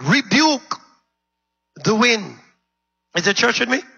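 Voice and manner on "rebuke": "rebuke" punched out higher; "rebuke" raised and driven home; stress on rebuke. Shown as a demonstration. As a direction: hit the word "rebuke" hard. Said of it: "Notice the word".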